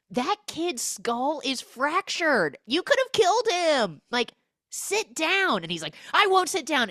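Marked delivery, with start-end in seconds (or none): 0.1-5.6 high, hammed-up outraged scolding. Vice principal grilling him in the office, mock outrage. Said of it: "[name] is grilling him". 6.1-6.9 high, loud refusal; mock defiant outburst. Student refusing the order in the office, mock defiant shout. Defiant reply in acted quarrel.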